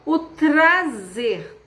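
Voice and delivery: trazer drawn out very slowly, TR clearly heard; slow demo word. Shown as a demonstration. Teacher to class pointing out the T R cluster, very slow. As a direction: stretch O trazer to show the T R. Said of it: "T R".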